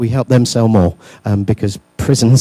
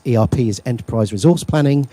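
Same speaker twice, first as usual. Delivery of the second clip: ordinary delivery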